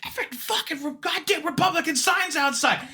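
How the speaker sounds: high, shouted complaint; mock yelling wife. Voter's wife yells at poll worker, high mock shout. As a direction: yell it like the furious wife. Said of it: "she's yelling at me".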